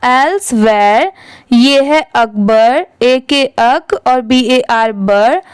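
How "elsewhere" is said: elsewhere stretched slowly, each part clear; slow model reading. Shown as a demonstration. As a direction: draw out elsewhere for the learners. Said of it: "दोनों मिला देंगे तो क्या हो जाएगा".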